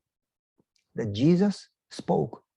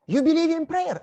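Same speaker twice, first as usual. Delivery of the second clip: high, louder surprised question from a woman; a lightly voiced lady, pitched way up; 12 semitones higher, 4 dB louder than usual. Lady on the left, surprised he prays, high voice.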